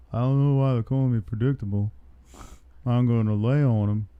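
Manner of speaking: lightly put-on Houston accent; Derek Lewis. Fighter answering a reporter about being predictable, imitated in a light Houston accent. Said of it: "get rid of the accent".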